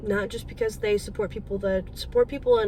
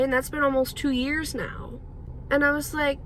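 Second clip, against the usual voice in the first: ordinary talk, nothing marked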